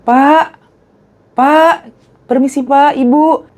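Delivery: polite greeting. Retold or imitated. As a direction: call out politely to an old man. [name] politely greeting elders.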